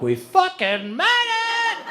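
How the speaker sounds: high, drawn-out cry of triumph; overjoyed. Retold or imitated. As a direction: cry out slowly in high-pitched relief. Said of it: "So happy".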